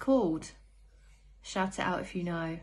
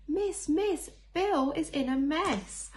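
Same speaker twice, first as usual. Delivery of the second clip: telling tales; 8 semitones higher than usual. Acted. Teacher reading a picture book, voicing a tattling child. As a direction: whine it to the teacher like a telltale.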